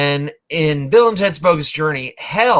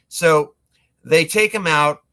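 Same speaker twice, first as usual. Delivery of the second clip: ordinary talk, nothing marked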